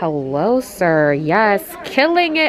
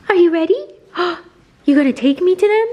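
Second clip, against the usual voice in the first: dog voice; 9 semitones higher, 4 dB louder, faster than usual. Acted. Voicing the dog.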